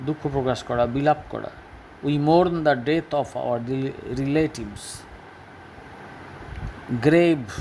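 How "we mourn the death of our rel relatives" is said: stretched, careful reading; slow model sentence. Shown as a demonstration. Teacher reading the example sentence slowly to pupils. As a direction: say it slowly for the class.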